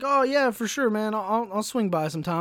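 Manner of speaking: high, eager over-friendly voice; people-pleasing yes to the veteran, bright and high; fake polite agreement. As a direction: sound keen while wanting out. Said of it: "I'm just trying to be nice".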